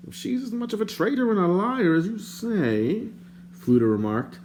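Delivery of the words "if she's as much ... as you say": higher, prim and indignant stranger; lightly voiced fussy stranger. A narrator's reading. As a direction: play the fussy bard, put out. The bard's voice.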